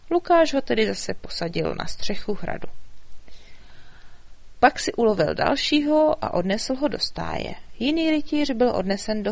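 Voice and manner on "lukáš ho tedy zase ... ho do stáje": usual speaking voice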